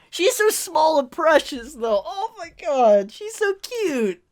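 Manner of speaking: high-pitched cooing, slowing down; adoring gush. Real, not acted. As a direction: coo over something small and precious. Gushing over cute character.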